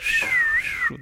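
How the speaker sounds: a high whistled wind sound; whistle. Shown as a demonstration. Preacher showing kids a wind whistle, high whistle. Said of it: "They make, like".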